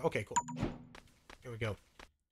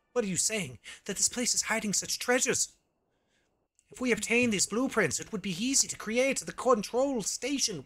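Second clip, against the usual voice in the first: higher-pitched, theatrical quest-giver voice; hammed-up game dialogue, pitched up; storytelling game character; 7 semitones higher than usual. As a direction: act out the tale of a failed mission. Reads game character's tale aloud.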